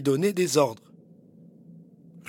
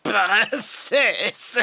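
high sneering knight, drawn out; sneering panto villain; 8 semitones higher than usual. Acted. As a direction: sneer the name like a stage villain. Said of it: "ricane-t-il".

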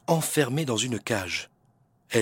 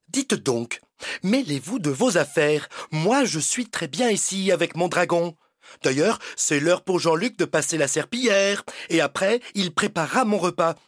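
haughty spoiled princess, shooing him off; bossy princess; 5 semitones higher than usual. Acted. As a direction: snap orders like a spoiled royal.

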